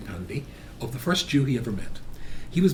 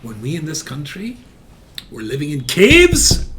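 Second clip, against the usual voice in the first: ringing, raised speech to a crowd; impassioned oration; 3 semitones higher, 6 dB louder than usual. Retold or imitated. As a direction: build loudly to the key images, then drop for the close. Retold rousing speech to an audience.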